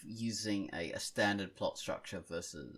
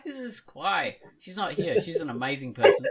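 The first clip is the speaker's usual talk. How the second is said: playful excitement; 8 semitones higher, 10 dB louder than usual. Acted. Playful feigned confusion.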